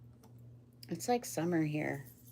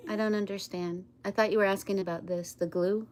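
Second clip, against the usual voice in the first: ordinary talk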